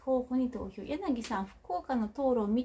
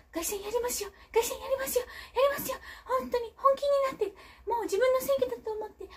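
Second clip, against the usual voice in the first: pushy rallying call; 12 semitones higher, faster than usual. Retold or imitated. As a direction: drive the councillors hard like a boss. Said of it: "パワハラって言われるんじゃないか".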